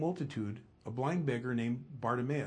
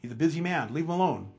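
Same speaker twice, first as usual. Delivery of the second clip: louder, higher acted scolding; stern rebuke; 4 semitones higher, 8 dB louder, faster than usual. Crowd telling the beggar to shut up, sternly. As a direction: shush him sternly, voice raised. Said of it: "sternly telling him to shut up".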